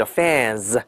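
"fans" with a long vowel and a voiced z ending; 词尾浊化. Shown as a demonstration. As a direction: stretch "fans" and buzz the final z. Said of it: "发浊化". ("fans"拉长，词尾发浊音z)

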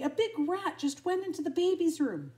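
high, loud, urgent warning; panicked storybook shout; alarmed cry. A narrator's reading. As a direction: raise the alarm for help. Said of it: "cried Lady".

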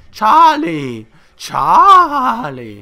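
high, loud drawn-out yell; long-distance calling. Pretend. Player calling for Charlie the unicorn, drawn-out shout. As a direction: holler the name into the distance.

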